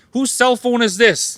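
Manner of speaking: lively quoted question; mock questioner. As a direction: play someone calling out whose phone it is. Mock asking whose phone.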